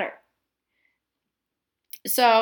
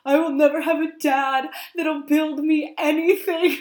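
half-laughing, self-pitying wail, pitched up and louder; mock melodramatic lament; 5 semitones higher, 4 dB louder than usual. Fan mocking her own tears over a movie dad, laughing lament. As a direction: overplay the sorrow while laughing through it. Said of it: "oh, I got so emotional 'cause I was like".

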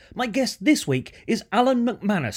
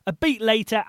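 ordinary talk, nothing marked

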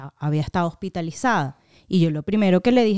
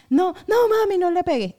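put-on much higher, louder child's plea; imitated pleading; 12 semitones higher, 4 dB louder than usual. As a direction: act out a kid begging mum to stop. Voices children pleading with mother.

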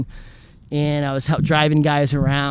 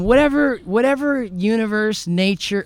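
pleading prayer; 7 semitones higher than usual. Retold or imitated. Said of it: "I'm really going".

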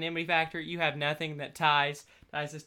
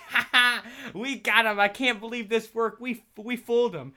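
loud, high, laughing brag; gloating laugh; 7 semitones higher, 6 dB louder than usual. Retold or imitated. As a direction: crow over the trick like you won. Friend gloating over a Zoom prank.

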